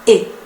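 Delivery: E as a short closed "é"; closed French é. Shown as a demonstration. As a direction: model E the acute-accent way. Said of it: "las cinco E se pronuncian igual".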